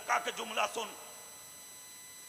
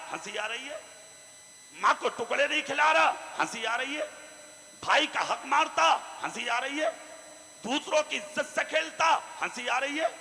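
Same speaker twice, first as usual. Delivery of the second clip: ordinary delivery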